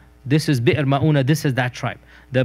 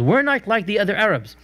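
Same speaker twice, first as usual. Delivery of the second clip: imitated self-praise, pitched higher; mock boasting; 6 semitones higher than usual. Lecturer imitating tribesmen boasting about themselves, mock bragging. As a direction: set yourself above the others, lightly mocking. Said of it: "they're boasting about themselves".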